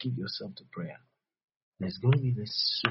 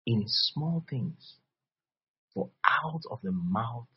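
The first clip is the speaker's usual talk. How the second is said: normal talking voice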